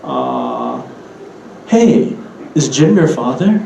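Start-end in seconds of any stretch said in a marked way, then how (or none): 1.7-3.6 English-style asking, rise on "father"; English question intonation. Shown as a demonstration. Teacher giving the English example, rising question on "father". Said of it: "another one of these".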